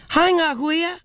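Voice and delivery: cheerful call. A narrator's reading. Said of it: "she called cheerfully".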